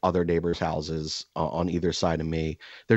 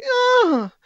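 very high, very loud fake moaning yell on a punch; fake orgasm yell; 10 dB louder than usual. Punching the heavy bag to annoy neighbours, loud high fake orgasm yell. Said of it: "as loud as I can with every single punch".

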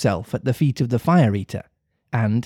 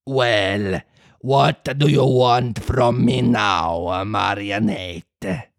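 slow proud puppet master turned kind; suddenly kind; 2 semitones lower, slower than usual. Acted. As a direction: lord it over the boy graciously. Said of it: "becoming suddenly kind and compassionate".

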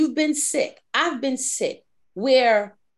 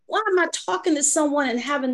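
high, loud, alarmed self-questioning; shocked disbelief; 8 semitones higher, 3 dB louder than usual. Acted. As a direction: protest in high-pitched bewilderment at your own tears. Acts out sudden tears mid-meeting.

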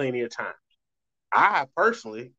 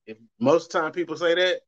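ordinary talk

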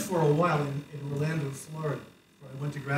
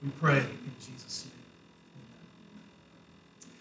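prayer; 2 semitones lower, 5 dB quieter than usual. Real, not acted. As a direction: pray quietly and reverently for the congregation. Leads the congregation in prayer.

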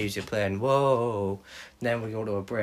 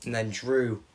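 ordinary talk, nothing marked

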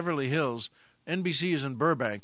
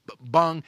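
louder mock ringing bong; imitated bong; 6 dB louder than usual. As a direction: sound out the head hitting the wall. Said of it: "there was almost a ringing bong to it".

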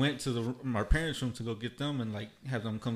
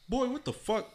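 much higher, startled dad voice; father finding a roach, startled; dad's outburst; 12 semitones higher than usual. Retold or imitated. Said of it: "and he's like".

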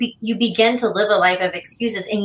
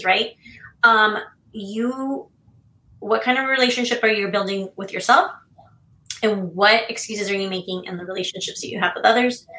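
normal talking voice

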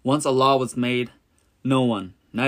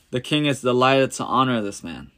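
ordinary delivery

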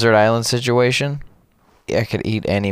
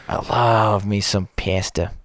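slow mock Italian. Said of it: "slow savoring Italian bit".

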